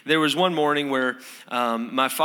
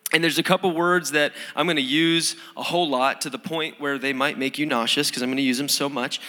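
usual speaking voice

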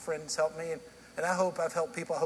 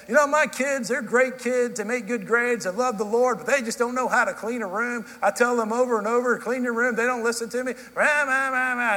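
higher, louder griping rant; hammed-up parental grumbling that runs into rah-rah noises; mock grumbling; 5 semitones higher, 5 dB louder than usual. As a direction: complain on and on about the kids. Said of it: "I'm just grumbling, going like this".

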